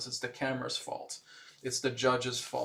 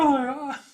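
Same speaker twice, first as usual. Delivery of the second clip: real laugh; 13 semitones higher, 9 dB louder than usual. Real, not acted. Laughs at own impression.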